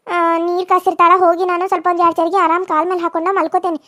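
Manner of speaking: ordinary delivery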